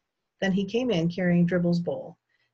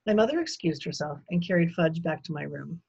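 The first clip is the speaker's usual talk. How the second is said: usual speaking voice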